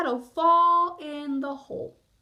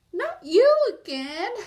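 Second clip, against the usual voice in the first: high, loud exasperated yell; 7 semitones higher, 8 dB louder than usual. Acted. Bear annoyed to see his shadow, loud yell. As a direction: shout it at the shadow. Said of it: "yelled Bear".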